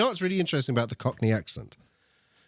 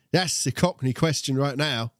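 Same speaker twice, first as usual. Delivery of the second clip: lively Cockney twang; Cockney accent; 3 semitones higher than usual. Acted. Streamer quizzing a new viewer, in a Cockney accent. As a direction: put on a quick touch of Cockney. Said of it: "I did a Cockney accent just then".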